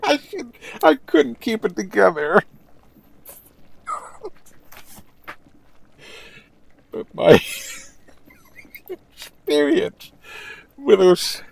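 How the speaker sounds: high, loud voice through laughter; barely holding it together; cracking up. Real, not acted. Streamer after telling the joke, laughing through words.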